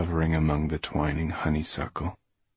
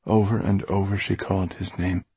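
plain reading voice

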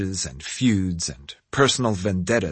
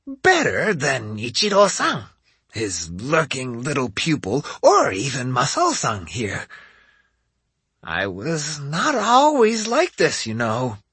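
put-on old woman voice; 4 semitones higher than usual. A narrator's reading. Old lady voice for Hansho.

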